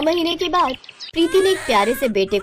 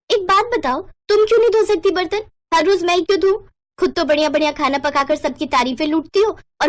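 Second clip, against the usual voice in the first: angry quarrel; 6 semitones higher than usual. Acted. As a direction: shout your grievance at her. Sisters quarrel over dishes.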